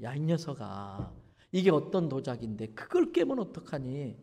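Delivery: gentle father. Retold or imitated. Father calling his son into the room, softly and gently. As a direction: call the boy in gently, not angry.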